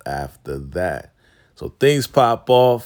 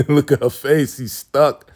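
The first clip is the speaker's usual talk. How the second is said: laughing; 5 semitones higher, 4 dB louder than usual. Real, not acted. Laughing at stuck character.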